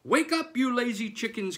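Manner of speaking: high, loud wake-up call; crying out. Acted. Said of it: "cried Farmer McPhee".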